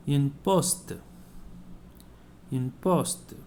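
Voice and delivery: poste said with the final T sounded; sounding the T. Shown as a demonstration. Teacher showing the T in poste, clearly sounded. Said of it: "Why I'm pronouncing the T".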